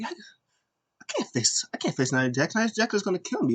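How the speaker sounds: hammed-up frightened whine; imitating Lana. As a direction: whine it like a nervous wrestler. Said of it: "Lana was like".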